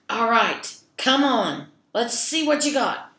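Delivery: loud daring troll; troll challenge. Acted. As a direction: dare the goat to fight.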